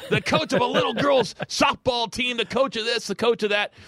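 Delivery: high mocking wrestling-promo voice, imitating The Rock. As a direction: taunt him like The Rock on the mic. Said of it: "And then he goes into".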